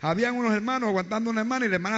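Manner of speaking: loud fiery sermon, shouting the faith; preaching at full force. Acted. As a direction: proclaim it at full voice to the congregation.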